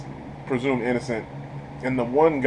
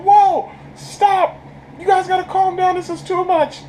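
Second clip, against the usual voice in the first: much higher, pleading mock voice; pleading peacemaker. Reviewer voicing Steven begging fighters to stop, high pleading. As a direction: squeak out a nervous plea to stop fighting.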